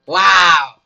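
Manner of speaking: loud, stunned wow; shocked shout. Real, not acted. Gamer shocked by his wife, loud surprised shout. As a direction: blurt it out in shock.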